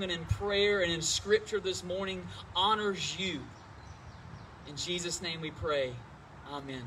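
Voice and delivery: praying reverently to open the service; opening prayer. Real, not acted.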